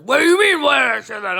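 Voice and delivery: high, very loud mock outburst; explode, imitating an offended hothead; outraged shout. Scorpio challenged over a remark, mock outraged shout. Scorpios snapping back in quarrel.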